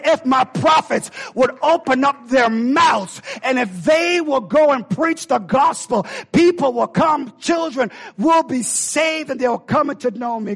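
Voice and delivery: preacher crying out God's promise to the church; shouted promise. Acted. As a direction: preach it at full force.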